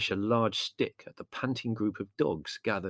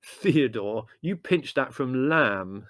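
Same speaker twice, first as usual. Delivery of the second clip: slow, firm accusation; loud, accusing tone; 4 semitones higher, 5 dB louder, much slower than usual. A narrator's reading. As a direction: call out the culprit, drawing it out. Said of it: "said Larry accusingly".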